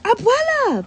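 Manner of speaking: high, happy cry of her name; overjoyed to see her; excited call; joyful call. Acted. Beto calls his grandmother.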